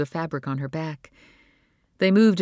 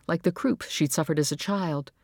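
ordinary delivery, nothing marked

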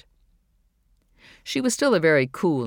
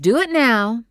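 firm mother; 9 semitones higher, 7 dB louder than usual. Acted. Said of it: "she said".

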